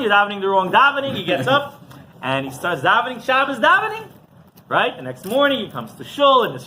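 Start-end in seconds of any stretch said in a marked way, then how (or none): 0.0-1.1 raised, hurried mock scolding; retold screaming. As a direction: imitate an indignant yell, quick and loud. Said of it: "starts screaming at the chazan".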